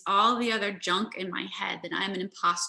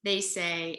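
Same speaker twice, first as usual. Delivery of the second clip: ordinary delivery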